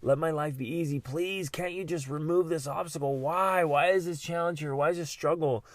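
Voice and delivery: high whiny plea; mock pleading prayer. Praying person begging for obstacles removed, mock whine. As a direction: beg like someone wanting life easy. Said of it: "We often pray, like".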